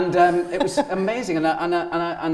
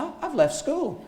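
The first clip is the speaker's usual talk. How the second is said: casual announcement; 12 semitones higher than usual. Retold or imitated. Telling his mate the news.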